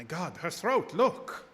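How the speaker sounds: startled as you spot the marks; alarmed exclamation at the wound. Acted. Doctor alarmed, discovering bite marks.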